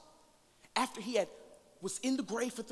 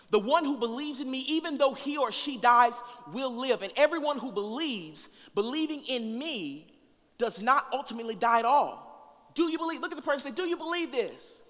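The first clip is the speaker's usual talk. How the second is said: preaching at full force; 6 semitones higher, 6 dB louder than usual. Acted. Preacher reading Martha's plea to the congregation, full force. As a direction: proclaim each line to the room.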